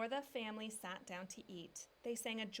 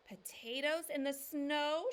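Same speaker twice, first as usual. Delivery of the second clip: higher, louder and slow, astonished; shout; 7 semitones higher, 8 dB louder than usual. A narrator's reading. Mama shouting back at Papa in disbelief. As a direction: call it out in disbelief. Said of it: "shouted Mama".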